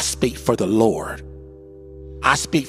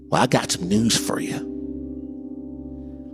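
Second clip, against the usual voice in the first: hushed warning; 3 semitones lower, 5 dB quieter, much slower than usual. Acted. Preacher drops to hush.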